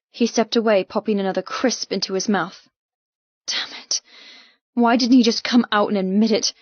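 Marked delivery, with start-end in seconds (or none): none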